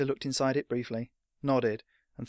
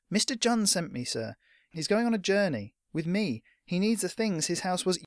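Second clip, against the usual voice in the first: high-pitched earnest lad; young messenger pressing his case; pleading boy; 7 semitones higher than usual. Acted. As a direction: insist urgently, politely, as a boy.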